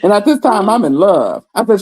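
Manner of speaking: ordinary talk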